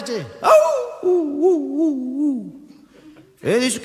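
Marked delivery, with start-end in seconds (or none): 0.4-2.7 imitated dog howl, rising high. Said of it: "el perro empieza a aullar". (aullido de perro imitado, agudo)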